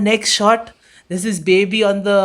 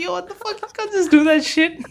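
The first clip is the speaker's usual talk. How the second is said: mock outrage; 11 semitones higher than usual. Retold outburst at roommate.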